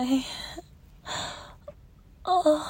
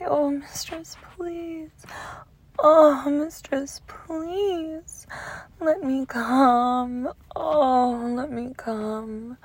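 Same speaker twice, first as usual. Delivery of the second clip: higher, breathy pleading moan; begging lover; 4 semitones higher, slower than usual. Retold or imitated. Mistress mimicking the lover's pleas, breathy begging. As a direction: imitate a lover begging, drawn out and whispery. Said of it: "when you beg and say".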